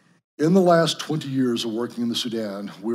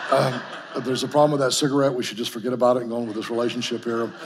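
pleading with a laugh; half-laughing, sheepish plea, a bit fast; 4 dB louder, faster than usual. Retold or imitated. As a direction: bargain with God through a chuckle. Bargaining with God, laughing.

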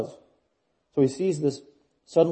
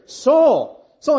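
loud, high, personal summons by name; personal call; 10 semitones higher, 8 dB louder than usual. Acted. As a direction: call him out by name, insistently. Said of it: "he's very personal".

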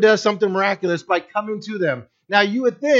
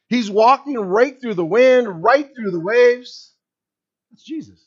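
fervent preaching; 6 semitones higher, 6 dB louder than usual. Acted. Preacher building to climax.

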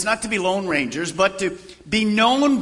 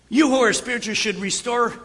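ordinary talk

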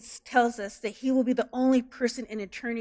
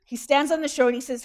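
ordinary delivery

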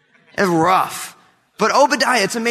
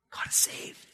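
breathy whisper of God is saved; 15 dB quieter than usual. Acted. Preacher whispers to congregation, hushed. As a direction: whisper it as a secret awe.

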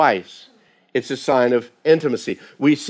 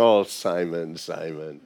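much softer and lower, tenderly saying the name twice; intimate, caring call; 8 semitones lower, 7 dB quieter, slower than usual. Retold or imitated. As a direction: call the name gently, like a worried friend. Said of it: "a way of expressing intimacy and care".

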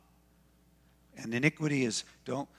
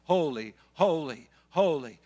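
louder rising cry of holy, holy, holy; seraphim crying out; 3 semitones higher, 6 dB louder than usual. Retold or imitated. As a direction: call it out like the seraphim.

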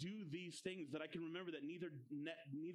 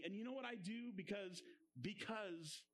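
normal talking voice

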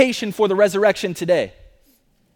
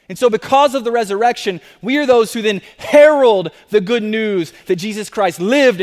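loud, higher, driving sermon delivery; full-force preaching; 4 semitones higher, 6 dB louder than usual. Acted. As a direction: build the proclamation with fervor. Climactic preaching on resurrection.

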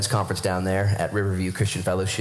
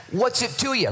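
higher, pointed comeback; Jesus answering Peter with higher sarcasm; sarcastic Jesus; 12 semitones higher than usual. Retold or imitated. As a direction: brush off the nosy question. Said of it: "He says something completely sarcastic".

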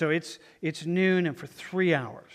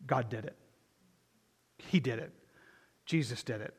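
lower, softer statements with pauses between; hushed testimony; 5 semitones lower, 7 dB quieter than usual. Acted. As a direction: say it quietly, letting each one land. Preacher voices believers' awed answer.

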